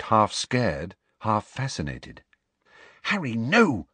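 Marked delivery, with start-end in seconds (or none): 3.0-3.9 urgent, high plea, voiced not whispered; warning. A narrator's reading. Student Hermione tugging Harry's sleeve, warning. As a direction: warn him off urgently, pitched up. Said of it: "whispered in a warning voice".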